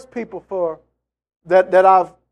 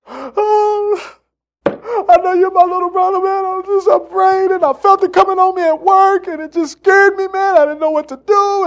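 much higher, sobbing voice of a frightened man; crying brother; 12 semitones higher, 4 dB louder than usual. Retold or imitated. Brother after a stroke crying to his younger brother, frightened. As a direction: let the words break like a scared stroke patient. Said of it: "he's a mess".

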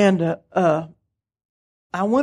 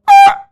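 a very high, loud startled yelp; shrieking in shock at the voice; 12 dB louder than usual. Retold or imitated. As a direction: jump in fright. Startled by God's shout.

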